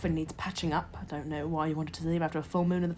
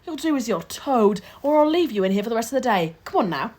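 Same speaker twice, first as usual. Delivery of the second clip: stern scolding; 5 semitones higher, 9 dB louder than usual. Acted. Matron scolding boy.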